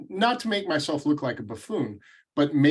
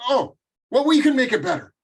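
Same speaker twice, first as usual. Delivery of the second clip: much higher, louder mock Russian accent, backpedalling; hammed-up Russian accent, suddenly sheepish; bad Russian accent; 12 semitones higher, 6 dB louder, faster than usual. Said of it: "I'm gonna do this Russian accent horribly".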